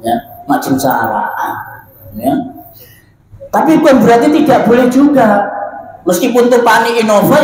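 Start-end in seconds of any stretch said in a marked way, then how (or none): 3.5-7.4 emphatic. Real, not acted. Emphatic correction to congregation.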